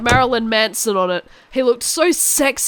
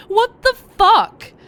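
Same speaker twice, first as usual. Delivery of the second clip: outraged disbelief; 10 semitones higher, 5 dB louder, slower than usual. Acted. Reacting to villain's proposal.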